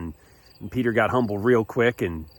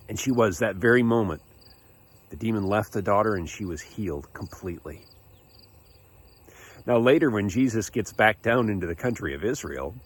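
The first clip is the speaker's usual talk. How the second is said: usual speaking voice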